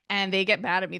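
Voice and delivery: normal talking voice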